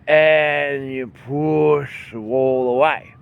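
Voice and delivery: very slow, strained push of the words. Shown as a demonstration. Teacher showing the wall push to students. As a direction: press the words out like moving a wall. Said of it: "demonstrating pushing motion".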